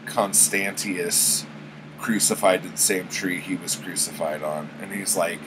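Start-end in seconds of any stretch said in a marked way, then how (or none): none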